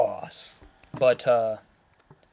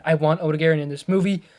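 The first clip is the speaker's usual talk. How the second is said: ordinary delivery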